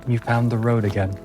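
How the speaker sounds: ordinary delivery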